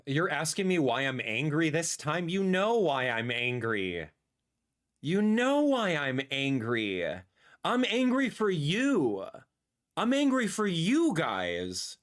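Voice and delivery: slow, emphatic explaining; slow deliberate. Real, not acted. Streamer telling viewers why he's angry, slow and emphatic. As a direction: spell it out slowly to chat.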